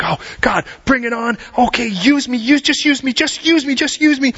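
eager pleading. Retold or imitated. Sarah at church begging God, climbing in eager excitement. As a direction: beg God to use her, rising each time.

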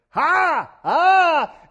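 loud, high triumphant "Ha! Ah!"; gotcha cry. Pretend. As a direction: pounce on the answer like a trap snapping shut. Mocking gotcha cry at Jesus answer.

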